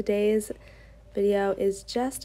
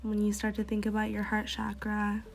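soft, unhurried meditation guide voice; guided meditation; 7 dB quieter than usual. Acted. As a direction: lead them gently into calm, breath by breath. Yoga teacher leads a meditation.

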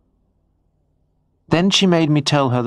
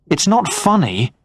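angry; 8 semitones higher than usual. A narrator's reading. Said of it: "I said angrily".

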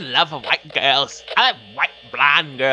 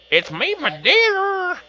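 much higher, slow, sing-song falsetto; 14 semitones higher, slower than usual. Shown as a demonstration. Redoing his sign-off to viewers in a high slow falsetto. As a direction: stretch it out way up high and sweet. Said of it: "Or it's me, my dear".

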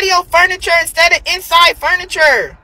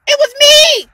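high boastful; 9 semitones higher than usual. Real, not acted. Bragging she is the catch.

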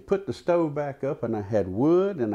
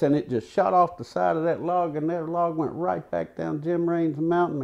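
ordinary delivery, nothing marked

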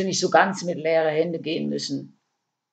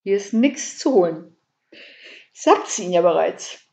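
whining, croaky complaint; 3 dB louder than usual. A narrator's reading. Käthe repeats it to the burglar, mildly whining. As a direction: whine it with a touch of quack. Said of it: "jammerte Käthe mit einem mittlerweile unüberhörbaren Quaken in der Stimme".

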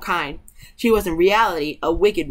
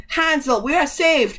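joyful cry rising much higher; 7 semitones higher than usual. A narrator's reading. Gretel freeing Hansel from the stable, crying out. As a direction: call out the good news, pitch lifted. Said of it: "and cried".

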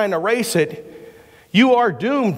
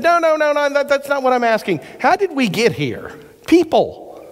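put-on child's insistent voice; 9 semitones higher than usual. Boy insists to his mom.

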